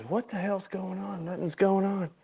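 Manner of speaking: soft, high retold muttering; quiet, bewildered aside; hushed puzzled murmur. Players murmuring as they wait.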